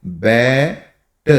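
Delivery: बैट said slowly, vowel held; slow motion word. Shown as a demonstration. Teacher showing बैट slowly to learners. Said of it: "slow motion में थोड़ा सा करो".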